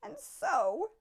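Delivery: high little creature voice; Lorax voice. A narrator's reading. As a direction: Lorax addressing the Onceler, high voice. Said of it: "said the Lorax".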